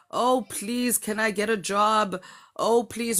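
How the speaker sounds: hammed-up grovelling; mock begging. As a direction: plead in a put-on begging voice. Acted begging for a job.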